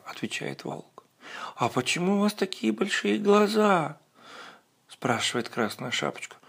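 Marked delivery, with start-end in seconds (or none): 1.6-4.0 put-on much higher, wondering child; little girl voice. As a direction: ask like a puzzled little girl. Girl questions the wolf.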